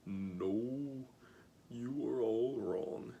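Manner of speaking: usual speaking voice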